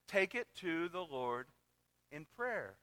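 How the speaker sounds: slow, soft hymn phrase; hymn line, tender. Retold or imitated. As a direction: gently give the true lyric. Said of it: "that's not the lyric".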